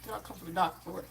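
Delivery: ordinary talk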